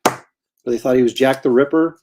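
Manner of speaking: normal talking voice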